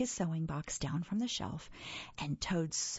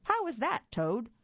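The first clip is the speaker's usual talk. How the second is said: Frog asking; 6 semitones higher, 4 dB louder than usual. Acted. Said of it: "said Frog".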